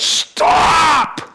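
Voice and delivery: high, loud, drawn-out shout; retold yell. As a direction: yell it out like a bishop halting everyone. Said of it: "and he yells".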